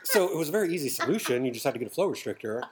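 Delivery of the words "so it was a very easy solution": laughing. Real, not acted. Laughing through the story.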